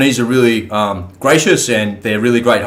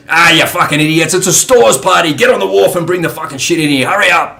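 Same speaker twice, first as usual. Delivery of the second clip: mock barking chief; 6 semitones higher, 3 dB louder than usual. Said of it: "And he goes".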